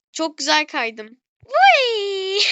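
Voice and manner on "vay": surprised whoop. Real, not acted. Exclaims going down slide.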